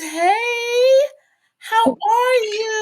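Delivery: high, squealing hello; light up greeting a friend; excited greeting. Real, not acted. Greeting the guest on arrival.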